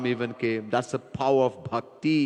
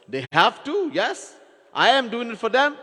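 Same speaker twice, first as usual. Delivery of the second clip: Valmiki answering; 8 semitones higher than usual. Acted. Valmiki answers sage Narad.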